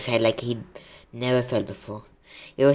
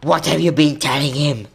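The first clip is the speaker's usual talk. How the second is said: loud, accusing demand; angry growl; 3 semitones higher, 6 dB louder than usual. A narrator's reading. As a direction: demand it loudly, as an angry centaur. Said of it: "growled Bane".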